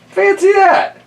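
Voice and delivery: hammed-up old lady, astonished; amazed old lady. Old lady hearing of stone soup, high amazed squeal.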